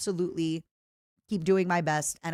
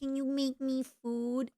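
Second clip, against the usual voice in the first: whiny plea; 7 semitones higher, 4 dB quieter, slower than usual. Pretend. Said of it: "Imagine I was like".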